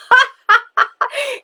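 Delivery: high cackling witch laugh; witch cackle. Retold or imitated. The witch laughing at the paper girl, a high cackle.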